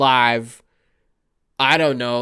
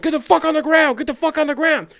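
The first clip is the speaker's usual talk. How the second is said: much higher, louder barked command; SWAT shout; 12 semitones higher, 5 dB louder than usual. Retold or imitated. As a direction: yell at them to get down. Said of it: "being like".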